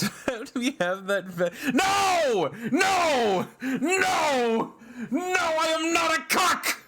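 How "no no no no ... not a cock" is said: high shouted no; yelling denial way up high; mock outraged shout. Shouted denial at chat joke.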